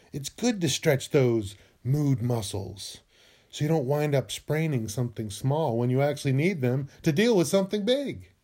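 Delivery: usual speaking voice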